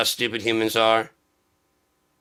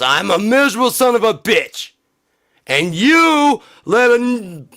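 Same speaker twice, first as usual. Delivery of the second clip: higher and louder, building to a furious shout; ranting shout; 10 semitones higher, 6 dB louder than usual. Acted. Angry voter yelling at the liberals, furious shout. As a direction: rant it up into a slow, pissed-off yell.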